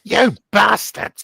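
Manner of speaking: high, loud, drawn-out sitcom imitation; hammed-up British character shout; shouted catchphrase. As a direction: belt out a mock British insult. Repeats the character's catchphrase, acted.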